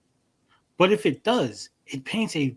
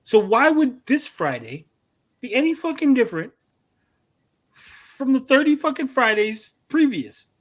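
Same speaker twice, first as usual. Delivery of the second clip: exasperated rant; 9 semitones higher than usual. Retold or imitated. Ranting retelling at coworker.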